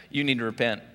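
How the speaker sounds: ordinary talk, nothing marked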